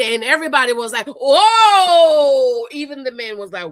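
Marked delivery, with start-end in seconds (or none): 1.1-2.7 loud, high, drawn-out shocked whoa. Retold or imitated. The group reacts to him calling her crazy, stunned whoa. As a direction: gasp it out like a stunned room.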